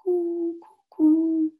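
high cooing for the audience to join; dove cooing demo. Shown as a demonstration. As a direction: show them how to coo like a dove. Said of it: "You can do a bit of cooing too".

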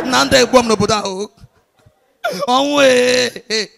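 hammed-up tearful moaning; drawn-out put-on sobbing lament; mock crying. A cheated buyer bemoaning his loss, mock crying. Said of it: "the guy shop, they cry".

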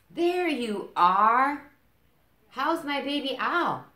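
high, loud, slow doting mother; high, sing-song motherly greeting; loving Mama. Acted. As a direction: greet your little one with delight.

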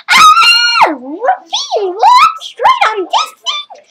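high, slow panicked cry; freaked out. Acted. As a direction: scream it in panic. Discovers camera, screams.

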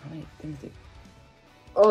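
usual speaking voice

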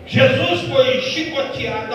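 ordinary talk, nothing marked

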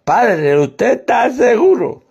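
put-on slow, slurred drunk voice; drunkard. Drunk asks the priest on a bus, slurring slowly. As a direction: drag the words out like a drunk. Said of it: "Dice el borracho".

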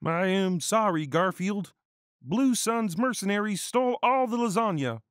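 high, loud, put-on anxious voice; worried councilman. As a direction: deliver bad news in a hammed-up high voice. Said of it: "said the council with worry".

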